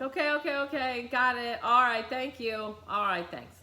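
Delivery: brushing her off. Retold or imitated. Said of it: "I'm like".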